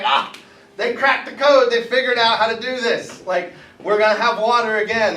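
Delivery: high, bright burst of relief; relieved excitement. Acted. Pharaoh to his court, relieved and excited. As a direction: Pharaoh thinks his magicians solved it. Pharaoh sees clean water.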